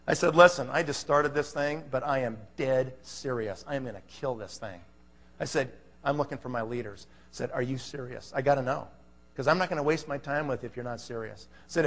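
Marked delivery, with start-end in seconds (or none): 0.3-4.8 low, quiet, dead-serious resolve; firm posture. Retold or imitated. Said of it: "can you guys hear my posture". 6.0-7.1 quiet, low recruiter's confidence; firm posture. Retold or imitated. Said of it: "can you guys hear my posture". 7.5-11.5 low, quiet challenge; firm posture. Retold or imitated. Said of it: "can you guys hear my posture".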